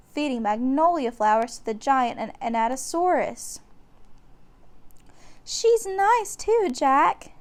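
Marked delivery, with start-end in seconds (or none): 5.4-7.3 put-on much higher, slow girlish voice; young girl delighted; little girl. A narrator's reading. Annie telling Jack, high slow girlish voice. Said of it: "she said".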